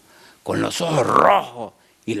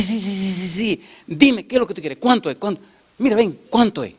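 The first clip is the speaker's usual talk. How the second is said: high-pitched impatient mock hurry; desperate; 6 semitones higher than usual. Woman wants the bookseller gone, desperate impatience. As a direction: imitate her rushing him to name the price. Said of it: "así como desesperada".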